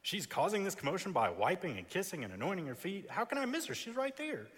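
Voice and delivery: mock incredulity, rising high at the end; imagined indignant reply. Simon inwardly protesting the woman disrupting his party, exasperated. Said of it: "I can imagine Simon thinking".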